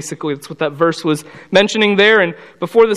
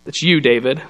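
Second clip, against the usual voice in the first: calm confrontation; 5 semitones lower than usual. Retold or imitated. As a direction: say it calmly, no outburst. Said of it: "just looks at him calmly".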